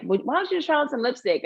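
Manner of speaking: high, sing-out suggestion from a friend; lightly voiced eager friend; coaxing friend. Retold friend coaxing her.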